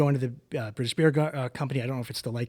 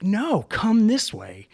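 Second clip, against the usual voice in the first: insistent beckon; 8 semitones higher, 5 dB louder, much slower than usual. Retold or imitated.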